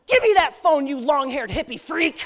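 snatch the phone in a furious huff; angry dad. Retold or imitated. Dad grabbing the phone from the hippie, angry shout. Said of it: "you hear my dad go".

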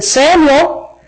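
high, drawn-out call of a name. Acted. The Lord calls sleeping Samuel, calling out high. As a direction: call out to someone from afar.

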